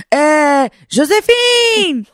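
high, drawn-out call of a dad on speakerphone; dad hollering out a name, stretched and high; imitating dad. Dad calling out to Josephine on the Zoom, imitated holler. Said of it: "he's got me on speaker and he's like".

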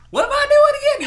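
very high, loud bewildered cry; mock panicked screech; confused shriek. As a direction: yell it like a lost inbred twin. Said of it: "It's like".

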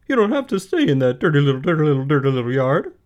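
high, loud, fast goose chatter; quick, loud honking goose voice; fast-talking goose. Acted. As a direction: rattle it off like a goose, repeating words. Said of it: "who talked rather fast".

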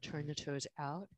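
usual speaking voice

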